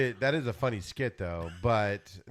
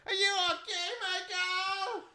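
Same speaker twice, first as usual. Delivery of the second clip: put-on much higher, thin worried old-man voice; mock elderly player checking on Michael; old teammate; 3 dB quieter, slower than usual. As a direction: fret over the fallen star like a frail old man. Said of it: "some other old guy standing over him going".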